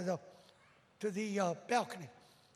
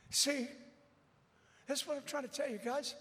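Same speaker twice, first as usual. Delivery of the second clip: normal talking voice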